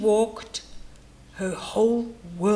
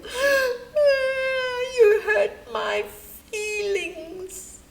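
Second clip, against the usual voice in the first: high wailing cry; 12 semitones higher, much slower than usual. Acted. Hippo wailing at a compliment, high and crying. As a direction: wail it out, voice way up, slow. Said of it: "she would wail".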